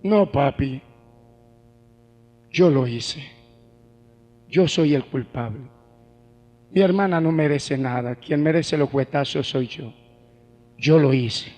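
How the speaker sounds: voz baja y apagada de niño arrepentido, sin llanto real; hijo lloroso imitado. Retold or imitated. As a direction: imita una confesión llorosa, en tono mucho más grave. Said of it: "empieza a llorar y me dice". (imitando a un niño que confiesa llorando)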